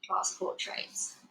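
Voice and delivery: ordinary delivery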